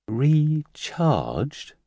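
high, baffled repeat of a strange word; awkward puzzlement. A narrator's reading. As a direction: echo the unknown word, puzzled. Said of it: "The nurse awkwardly repeated the word".

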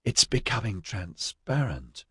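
slow, hoarse and hushed. A narrator's reading. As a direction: rasp it out, awestruck. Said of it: "Tundi said hoarsely".

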